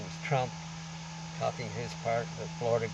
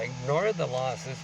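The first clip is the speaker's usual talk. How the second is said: slow emphatic quote; slower than usual. Acted.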